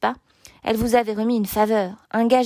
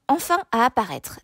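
ordinary delivery

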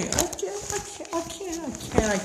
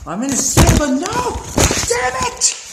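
high, loud furious shout; raging yell; 8 semitones higher, 7 dB louder than usual. Real, not acted. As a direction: scream at the game. Dying again.

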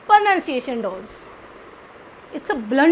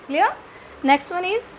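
ordinary delivery

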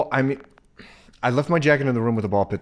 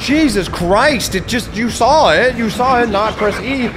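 high, loud yelling at the screen; frustrated yelling; 10 semitones higher, 5 dB louder than usual. Real, not acted. Gamer yelling at his character, frustrated shout. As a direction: scream at the fool who won't move.

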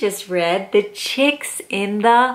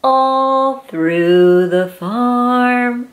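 drawn out slowly; slow refrain; much slower than usual. Acted. Reading to a child, slow sing-song refrain. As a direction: stretch each word out.

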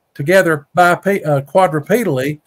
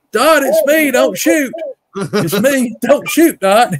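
calling out from afar; 8 semitones higher, slower than usual. Retold or imitated. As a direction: shout ahead so nobody fires. Dad calling from far end.

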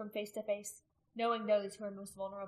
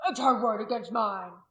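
loud, effortful rebuttal; ground out loudly; 9 dB louder than usual. A narrator's reading. Restrained man setting his word against hers, loudly. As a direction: force it out over the crowd. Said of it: "ground out as loudly as he could".

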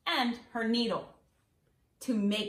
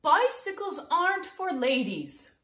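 mother's warning; 8 semitones higher than usual. Acted. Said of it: "Tilly's mother reminded her".

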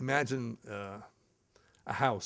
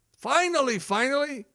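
relieved; 11 semitones higher, 3 dB louder than usual. Retold or imitated. Relief guest finally leaves.

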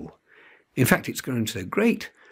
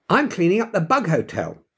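insisting; 7 semitones higher than usual. A narrator's reading. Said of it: "insisted Janie".

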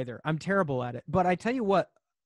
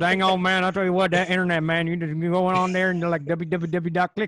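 Boomhauer impression; 2 semitones higher, 5 dB louder than usual. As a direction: mumble it like a lazy Texan neighbor. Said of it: "Are you Boomhauer from King of the Hill".

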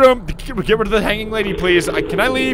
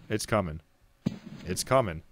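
much softer, tense murmur; hushed dread; 10 semitones lower, 10 dB quieter than usual. Real, not acted. Player warns viewers before a jump scare, hushed. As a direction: brace quietly for the scare. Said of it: "shield you from my scream".

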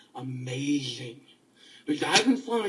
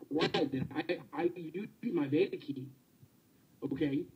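ordinary talk, nothing marked

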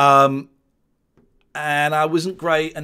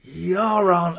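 put-on loud, raised drunken heckle; Brendan Burns; 6 semitones higher, 5 dB louder than usual. Drunk comic barges into the bar, imitated drunken taunt. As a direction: attempt a drunk man's accent, pushed high. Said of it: "drunk Brendan Burns came in".

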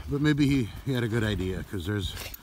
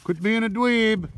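slow, higher teasing call; 9 semitones higher, slower than usual. Real, not acted. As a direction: tease the dog from afar. Teasing the dog, calling out.

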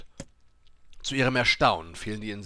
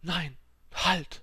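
high, alarmed "no, stop"; urgent protest; 9 semitones higher than usual. A narrator's reading. Dying woman stopping the apothecary's offer, high urgent protest. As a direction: cut in, pitched up sharply.

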